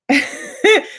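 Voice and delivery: a real, high, bright burst of laughter. Real, not acted. Laughing at her own Thanksgiving question, a high laugh.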